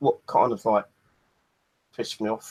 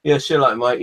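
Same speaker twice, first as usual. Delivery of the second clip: retold farewell; 3 semitones higher, 8 dB louder than usual. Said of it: "He goes".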